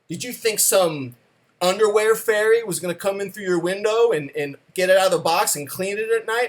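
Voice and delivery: mock the underwear fairy idea with scorn; sarcastic father. Father scolding his son about the underwear fairy, loud and sarcastic.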